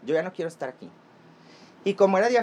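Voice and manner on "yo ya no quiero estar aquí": softly begging, near tears held back; hushed plea to dad. Retold or imitated. Begging her dad to get her out, softly. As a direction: beg quietly to be taken away.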